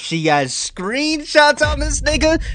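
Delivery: slow, loud, climbing to a very high pitch; astonished outburst. Real, not acted. Viewer hearing there are screenshots, loud astonishment. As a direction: stretch the words out and soar up in disbelief.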